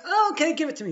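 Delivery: high, eager demand; excited mother asking her son for the afikomen; mock eager. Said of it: "I'm like".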